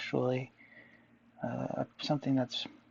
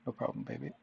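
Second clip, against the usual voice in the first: [name] impression; 2 semitones lower, 5 dB quieter, faster than usual. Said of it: "[name] impression voice".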